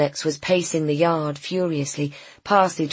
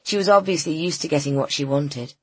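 ordinary delivery, nothing marked

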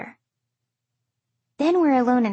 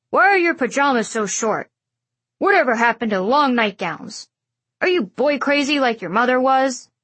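cranky grandfather voice, louder; grumpy old man; 4 dB louder than usual. A narrator's reading. Grandfather scolds about pajamas.

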